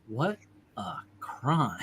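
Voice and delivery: "What a crime" drawn out very slowly and softly, each word dotted; stretched out. Shown as a demonstration. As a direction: linger on every word of "What a crime", slow and deadpan. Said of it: "just stretched out where he just dots it".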